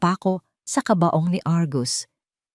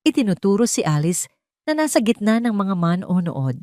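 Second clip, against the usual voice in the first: usual speaking voice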